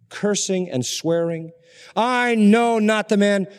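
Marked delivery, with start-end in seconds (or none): 2.0-3.5 slow, loud, higher defiant denial; Peter's denial. Acted. Peter denying Jesus, slow and loud.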